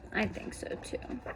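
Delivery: whispered, gentle reassurance; soft agreement. Real, not acted. Reader answers the children, soft whispered reassurance. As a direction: lean in and whisper it kindly.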